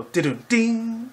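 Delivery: slow, high "Didun ding" like a rimshot; mock drum fill; imitated drum sting. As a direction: hum the drum and tambourine sting. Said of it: "They go".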